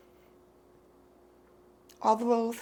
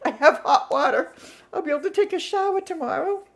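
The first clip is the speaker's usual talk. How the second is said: laughing; 7 semitones higher, 4 dB louder than usual. Real, not acted. Laughing at bright side.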